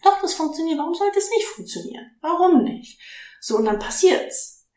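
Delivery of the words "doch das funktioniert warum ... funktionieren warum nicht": confident inner voice. Retold or imitated. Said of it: "ich hab irgendwie das Gefühl gehabt".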